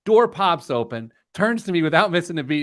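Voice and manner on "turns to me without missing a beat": normal talking voice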